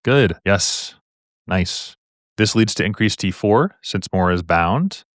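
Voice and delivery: ordinary talk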